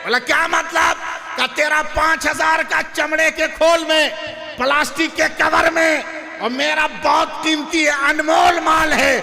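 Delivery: fervent sermon proclamation; preaching at full force. Acted.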